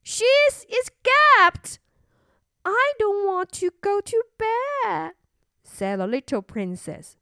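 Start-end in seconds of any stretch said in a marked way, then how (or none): 0.0-1.8 high, loud, drawn-out cry; alarmed queen. Acted. Queen announcing princess has escaped, loud alarm. 2.6-5.1 put-on high little-girl refusal; whining little princess. Princess caught escaping, refusing bed, whining.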